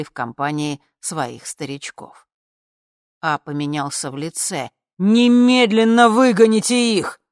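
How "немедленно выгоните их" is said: loud, high, furious order; full-voiced enraged shout; yelling. A narrator's reading. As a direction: bellow for them to be thrown out. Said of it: "заорал он".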